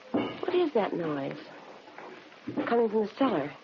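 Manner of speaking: listen with a growing unease; uneasy. Acted. Suspect hearing the police dig in her cellar, uneasy and soft.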